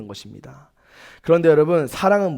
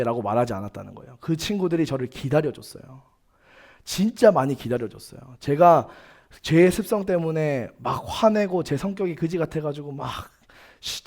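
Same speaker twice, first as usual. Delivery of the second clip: ordinary talk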